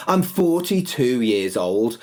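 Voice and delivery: ordinary delivery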